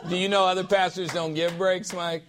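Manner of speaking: playful laughing jab. Real, not acted. Pastor teasing Mike about breaks, laughing. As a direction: rib him with a laugh.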